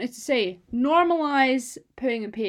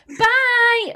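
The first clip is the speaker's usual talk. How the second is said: much higher, much louder yell of bye; mum's loud bye; 11 semitones higher, 9 dB louder than usual. Retold or imitated. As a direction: holler it from a car window. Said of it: "really, really loudly".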